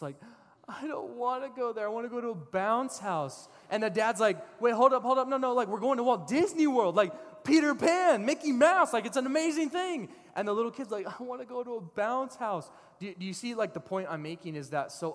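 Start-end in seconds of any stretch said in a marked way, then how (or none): 0.7-3.5 high, loud mock wail; crying little kid. Little kid protests from the back, mock crying. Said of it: "his little kid is in the back crying". 4.6-10.1 very high, loud, incredulous pleading; exasperated hyped-up dad. Acted. Dad persuading kid about Disney World, loud disbelief. 11.1-12.7 high, mock-whining child voice; crying little kid. Little kid still refusing Disney World, mock whine. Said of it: "his little kid is in the back crying".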